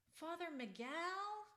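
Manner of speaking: high, slow cracking call holding back tears. A narrator's reading. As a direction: call out slowly, voice breaking high. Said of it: "His voice cracked as he held back tears".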